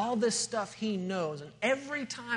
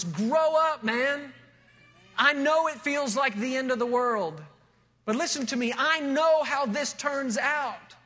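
loud and firm, grow up man; firm raised plea; 4 semitones higher, 4 dB louder than usual. Retold or imitated. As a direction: tell him straight to grow up, loudly. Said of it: "What was I saying to this young guy".

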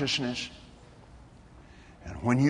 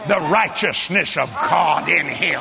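ordinary delivery

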